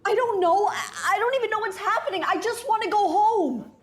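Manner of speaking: high, frightened and near tears; panicked. Acted. Lost in shifting time, Dana panics to the Doctor, high and frightened. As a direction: voice climbs in panic, begging to go home.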